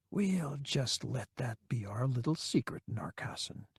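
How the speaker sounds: whispered secret; hushed conspiratorial whisper; under his breath. Acted. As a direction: breathe it to the man alone. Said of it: "he said under his breath".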